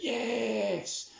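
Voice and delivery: a high, joyful yell of Yes!; excited shout. Acted. Fan cheering a football goal, excited high shout. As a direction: cheer like your team just scored. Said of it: "your team have scored a goal and you might shout".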